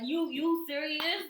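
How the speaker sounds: mock disbelief. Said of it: "I'm gonna be watching it like".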